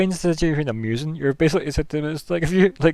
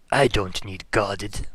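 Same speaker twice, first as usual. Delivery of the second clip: slow NPC voice; 4 semitones lower, much slower than usual. Acted.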